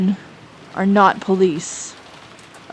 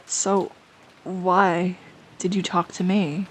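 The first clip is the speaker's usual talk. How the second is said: ordinary delivery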